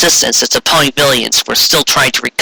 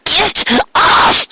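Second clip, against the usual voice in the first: much higher frantic cry; Dizzy struggling. A narrator's reading. Dizzy pinned under Trixie, high frantic cry. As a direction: shove her off in panic. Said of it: "Dizzy Doo interrupted".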